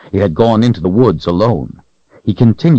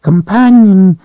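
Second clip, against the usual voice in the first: high whining creature voice; creature whine; 12 semitones higher, 5 dB louder, slower than usual. A narrator's reading. Creature whining to Richard about the Calthrop. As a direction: whine it out, cringing.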